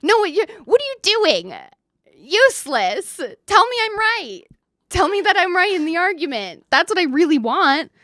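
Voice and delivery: high, loud mock tantrum at the phone; screaming at the video for validation; mock furious rant. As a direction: rage comically at a useless guru. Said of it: "And I'm like".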